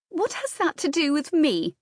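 high, petulant challenge; indignant demand. A narrator's reading. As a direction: demand it indignantly, pitched well up. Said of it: "demanded Anne".

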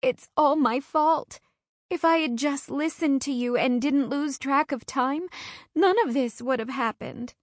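put-on crying girl blaming herself; sobbing. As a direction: sob out the guilt. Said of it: "I sob".

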